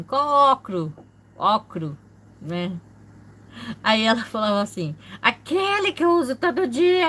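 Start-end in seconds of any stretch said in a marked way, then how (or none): 0.0-0.9 teasing question. Retold or imitated. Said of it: "Aí eu falava assim". 5.2-7.1 much higher, louder cross mother; mother snapping back about her everyday glasses, high and loud; annoyed mother. Retold or imitated. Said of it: "Aí ela ficava brava".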